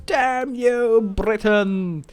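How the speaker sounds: high, drawn-out mock curse; hammed-up cursing of an arch-enemy; mock outrage. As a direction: shake a theatrical fist at your rival. Mock cursing of attacking rival.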